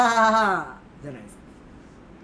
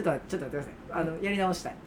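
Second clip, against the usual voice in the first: deflated; 8 semitones lower, 9 dB quieter, faster than usual. Real, not acted. Crushed comedian begs a redo.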